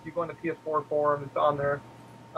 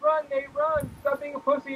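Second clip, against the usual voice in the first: taunting lady; 10 semitones higher than usual. Retold or imitated. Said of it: "The lady's like".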